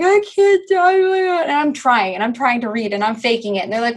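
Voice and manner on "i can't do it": high, drawn-out wail; imitated tearful childhood wail; mock crying. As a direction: whine it slowly like an overwhelmed, crying kid. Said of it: "I was crying".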